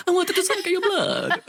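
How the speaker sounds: very high, laughing mock vampire; giggling bad Dracula impression. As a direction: ham up a vampire threat. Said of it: "he goes full Dracula".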